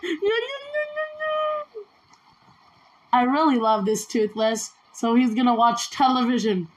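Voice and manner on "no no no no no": mock whine. Pretend protest at dragon leaving.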